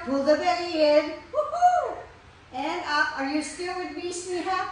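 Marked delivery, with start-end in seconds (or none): 1.3-2.0 much higher, louder cheering woohoo; rally the class with a whoop; joyful high cheer; excited whoop. Real, not acted. Cheering during hard exercise.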